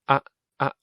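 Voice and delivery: ah cut off as short as possible; short ah vowel. Shown as a demonstration. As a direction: clip the ah hard. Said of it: "Try and make it very short".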